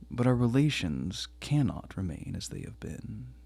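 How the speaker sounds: usual speaking voice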